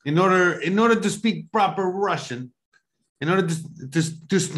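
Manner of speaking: stilted mock Russian accent; hammed-up Russian impression; terrible Russian accent. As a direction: lecture like a stock Russian. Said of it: "That's terrible".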